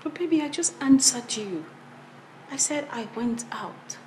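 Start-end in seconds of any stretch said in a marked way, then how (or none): 2.5-4.0 low, soft reply; subdued answer. Acted. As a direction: answer quietly, keep it low. Wife answers husband's questioning.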